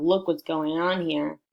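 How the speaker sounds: ordinary delivery, nothing marked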